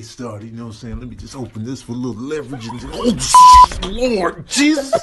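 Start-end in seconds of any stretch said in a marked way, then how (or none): 2.9-4.9 shocked cry. Acted. Shocked exclamation.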